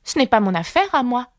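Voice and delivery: high-pitched plucky Alice; bold retort. A narrator's reading. Alice brushes off the queen's question: bold retort. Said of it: "surprise de son courage".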